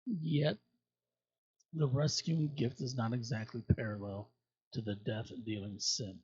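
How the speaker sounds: ordinary talk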